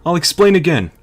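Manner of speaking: high, loud put-on briefing voice; Otacon. Briefing Snake on the target over codec, high-pitched Otacon voice. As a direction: pitch it way up, urgently explaining the mission. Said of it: "sorry about the Otacon voice".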